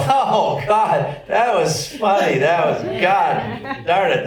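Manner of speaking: high, slow mock exasperation at a funny ad lib; exasperated animators. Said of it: "'Cause they'll go".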